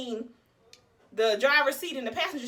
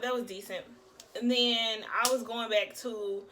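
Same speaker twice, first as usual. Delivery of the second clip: ordinary talk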